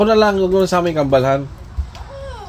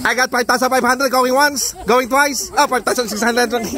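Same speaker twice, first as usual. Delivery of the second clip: auctioneer; 6 semitones higher than usual. Pretend. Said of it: "May bidding, eh".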